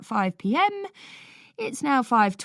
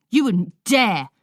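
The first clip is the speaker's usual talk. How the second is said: louder, slower defiant retort; shout back; 4 semitones higher, 7 dB louder than usual. A narrator's reading. Older brother shouting back at Paulie, defiant. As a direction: dare him back. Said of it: "Lloyd shouts back".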